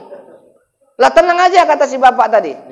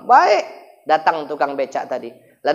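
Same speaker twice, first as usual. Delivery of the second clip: ordinary talk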